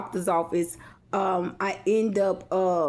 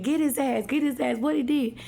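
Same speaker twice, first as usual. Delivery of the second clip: higher-pitched egging on; imitating onlookers; 5 semitones higher than usual. Bystanders watching the front-yard fight, higher imitating voice. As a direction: chant it like a crowd urging a fight. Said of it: "They were saying".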